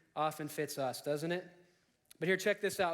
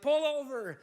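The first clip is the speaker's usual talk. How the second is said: higher, drawn-out call to pull over; mock police shout; 7 semitones higher, 3 dB louder than usual. Police officer to Harry in the dog van, mock shouted order. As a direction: imitate an officer calling out to a driver.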